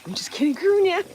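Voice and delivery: high, loud teasing through laughter; laughing tease. Real, not acted. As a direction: tease her, cracking up.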